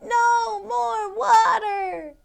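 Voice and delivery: high, loud, slow panicked scream; screaming mom. Acted. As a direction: shriek it as a frantic mother.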